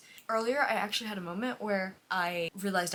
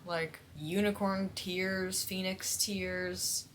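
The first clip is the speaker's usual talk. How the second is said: slow and dreamy; much slower than usual. Real, not acted. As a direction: savour each word slowly. Savouring lore.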